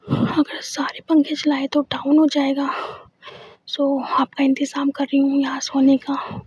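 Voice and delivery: ordinary delivery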